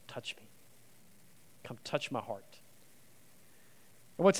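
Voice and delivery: soft, low and gentle; tender invitation. Acted. Jesus inviting doubting Thomas to touch him, softly. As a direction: coax him close in a near-whisper.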